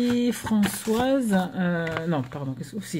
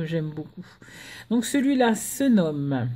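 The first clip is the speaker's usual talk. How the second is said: normal talking voice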